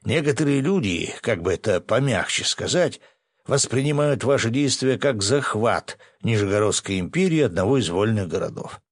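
ordinary delivery, nothing marked

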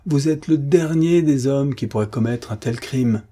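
high, appalled protest; stammering dismay. A narrator's reading. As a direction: plead in shock, broken on the repeated VOUS. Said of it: "balbutia le concierge". (effaré, voix haut perchée)

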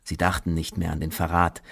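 ordinary talk

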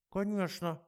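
high quacking voice through a tinny speaker; quacked over the intercom. Acted. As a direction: squawk it like a box on the desk. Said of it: "прокрякали из ящика".

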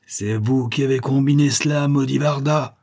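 the defeated giant cursing Maracotte; enraged cry. Acted. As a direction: spit the curse at the enemy. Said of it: "s'écria-t-il".

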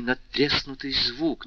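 usual speaking voice

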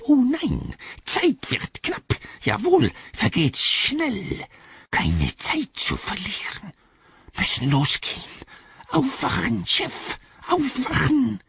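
high, drawn-out creature urgency; Gollum hissing. A narrator's reading. Gollum urges the boss to wake, hissing. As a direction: hiss and rasp to hurry them along. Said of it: "fauchte Gollum".

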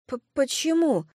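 high, stammered tipsy question; sincere surprise. Acted. Tipsy Kelsey asks why she is refused, surprised. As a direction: stumble on the word, genuinely puzzled. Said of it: "искренне удивилась Кэлси".

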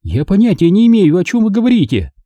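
high, sharp, offended Margo; angry denial. A narrator's reading. Margo angrily denying to Engel. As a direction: snap back at the accusation. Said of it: "На сей раз Марго рассердилась".

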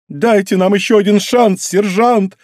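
high, strained begging; overwrought plea. A narrator's reading. Мизуки begs her sergeant for another try, overwrought. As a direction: beg the sergeant with anguish. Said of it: "с надрывом произнесла Мизуки".

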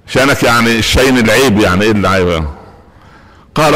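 ordinary talk, nothing marked

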